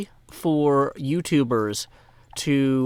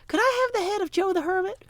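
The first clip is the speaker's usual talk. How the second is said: much higher, dainty mock-polite voice; sweet polite request. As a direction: ask for a head as if it were a cup of tea. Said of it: "was it his father or his wife that was like".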